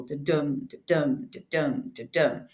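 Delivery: da-dum with a soft first beat and the stress on dum; da-dum said as an iambic beat with the weight on dum. Shown as a demonstration. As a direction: tap out a heartbeat rhythm, da then DUM. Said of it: "with a stress on every second syllable".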